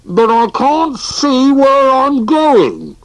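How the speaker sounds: high, slow and muffled under a sheet. Acted. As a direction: speak as if through cloth. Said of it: "came the muffled reply".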